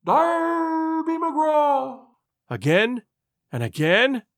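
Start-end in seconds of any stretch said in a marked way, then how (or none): 0.0-2.1 high, long-drawn ghostly hail; distant wail. A narrator's reading. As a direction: wail it from far away, every vowel stretched. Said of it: "it wailed".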